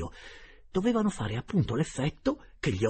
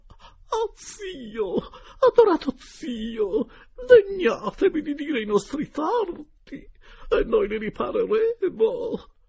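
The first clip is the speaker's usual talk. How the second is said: much higher, loud and slow tearful wail; wailing lady; 12 dB louder, much slower than usual. A narrator's reading. Geltrude begging the uncle's spirit, slow high tearful wail. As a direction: plead through tears. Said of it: "domandò".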